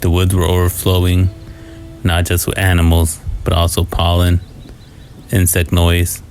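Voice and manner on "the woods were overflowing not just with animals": plain reading voice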